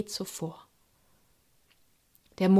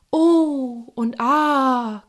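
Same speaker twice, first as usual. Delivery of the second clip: higher, louder oohs and aahs; awed gasps; 5 semitones higher, 6 dB louder, much slower than usual. Acted. Animals marvelling at the fire juggler, awed. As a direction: marvel at the show.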